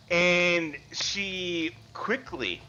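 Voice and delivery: ordinary delivery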